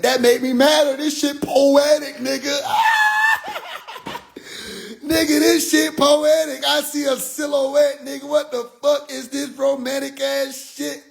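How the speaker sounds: high-pitched, drawn-out indignant wail; worked-up, comically exasperated rant; mad. Retold or imitated. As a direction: complain in a high, stretched-out whine of outrage. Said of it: "I got mad".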